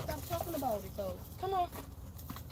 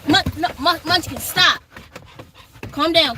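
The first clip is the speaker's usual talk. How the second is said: scolding dog; 8 dB louder than usual. Real, not acted.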